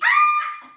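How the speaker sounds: very high, loud shriek of joy; gleeful bird shriek. A narrator's reading. Bird shrieks flying through wind. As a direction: screech with glee like a bird. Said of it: "The bird shrieked with glee".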